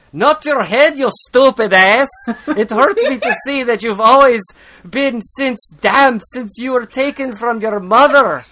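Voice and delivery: high, loud hammed-up accent for Chade. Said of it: "Offensive versions of an accent".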